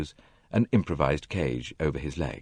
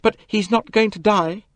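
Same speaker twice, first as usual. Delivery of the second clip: higher, louder frightened cry; fearful cry; 5 dB louder than usual. A narrator's reading. Jackie fearing Simon will die, in a frightened cry. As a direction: cry out in fear for him. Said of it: "cried Jackie".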